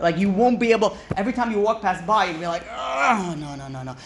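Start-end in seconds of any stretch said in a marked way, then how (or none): 2.7-4.0 acted temptation. Tenant passing the forbidden door, acted self-restraint. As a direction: talk yourself down from temptation.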